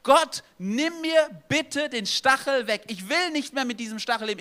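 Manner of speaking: high, loud pleading cry; loud, high desperate plea; crying out to God. Retold or imitated. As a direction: beg God to take the thorn away. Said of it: "und er schreit zu Gott".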